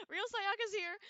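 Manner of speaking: high, loud excited welcome; excited announcement. Real, not acted. Streamer greeting an arriving viewer, excited and loud.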